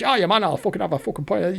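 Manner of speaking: quick, rough imitation of a Scottish mate; mock Scottish accent. Friend Hamish ordering a pint, fast mock Scottish accent. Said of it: "Jock and Hamish are Scottish".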